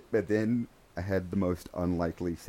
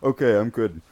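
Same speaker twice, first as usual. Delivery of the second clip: relieved; 10 dB louder than usual. Retold or imitated. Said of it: "I was like".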